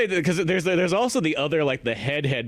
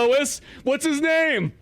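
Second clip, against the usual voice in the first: mocking demand; 12 semitones higher, slower than usual. Mock-angry boss demands a name.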